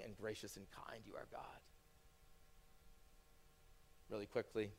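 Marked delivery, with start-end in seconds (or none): 0.0-1.6 soft breathless whisper; whispered prayer. Acted. Praying to God, hushed whisper. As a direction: breathe it out in awe.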